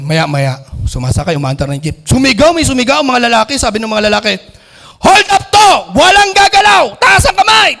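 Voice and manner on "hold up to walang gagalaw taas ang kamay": loud, high commanding yell; hold-up man yelling at everyone; robber's shout. Acted. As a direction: bark orders at the terrified passengers. Robbers holding up a jeepney.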